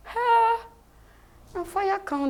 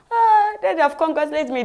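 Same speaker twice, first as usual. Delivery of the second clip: delighted exclaim; 7 semitones higher, 8 dB louder than usual. Retold or imitated. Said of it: "I was like".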